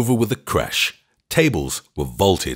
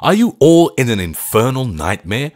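loud; 7 semitones higher, 4 dB louder than usual. A narrator's reading. Narrator still nearly screaming.

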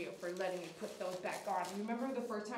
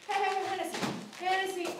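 high, louder warning call of the name; startled calling out; 9 semitones higher, 4 dB louder than usual. Real, not acted. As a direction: snap her name to stop the bite. Dog snaps, calling its name.

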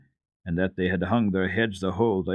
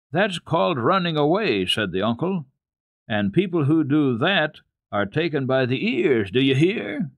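stern scolding; 7 semitones higher, 4 dB louder than usual. Acted. Uncle scolds truant boy.

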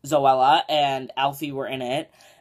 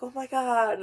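shocked cleaners; 10 semitones higher, slower than usual. Retold or imitated. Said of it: "And they were like".

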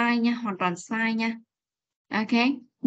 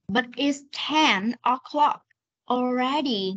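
"But it's ten o'clock already" stretched, pausing before "already"; slow reading; much slower than usual. Shown as a demonstration. Teacher rereads for a confused student, slow reading. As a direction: read "But it's ten o'clock already" slowly, each ending clear. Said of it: "Đọc chậm này".

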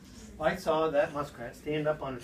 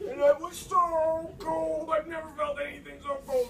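freezing; 10 semitones higher than usual. Retold or imitated. Reliving fall into icy water.

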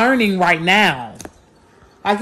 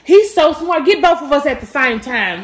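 gushing with pride; raised, louder, excited praise; 5 semitones higher, 6 dB louder than usual. Real, not acted. Proud gushing about her son.

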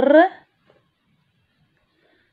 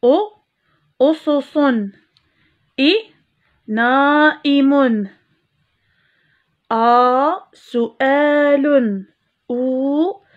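each hamza sound then a slow, louder model word; teacher model reading, emphatic and slow; reading drill; 3 dB louder, slower than usual. Shown as a demonstration. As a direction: drill أ then أظافر slowly for pupils. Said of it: "سنقرأ حرف الهمزة مع الحركات".

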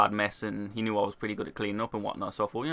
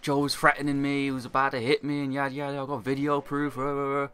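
lightly put-on telltale voice; mock tattling; 3 semitones higher than usual. Supervisor slagging off an employee to managers, mildly mocking imitation. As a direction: mildly mimic the boss telling tales. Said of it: "slagging me off and saying".

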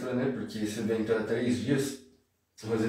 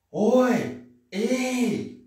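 high, drawn-out hailing calls; attention-getting call; 11 semitones higher, 4 dB louder than usual. Shown as a demonstration. Teacher showing Portuguese calls for attention, high hailing shout. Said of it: "pra chamar a atenção da pessoa".

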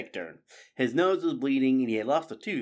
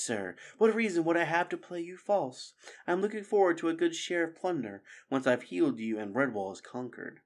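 sly fox voice; 7 semitones higher than usual. Acted. Sela fawns on Clooney.

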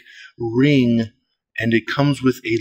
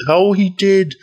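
excited; 7 semitones higher, 4 dB louder than usual. Real, not acted. Excited reaction to unboxing.

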